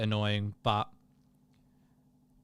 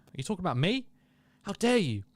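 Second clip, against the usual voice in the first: playfully affronted, pitch jumping high; mock indignation; 7 semitones higher than usual. Streamer feigning offence at a chat remark, mock indignation. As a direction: play offended, voice swooping up.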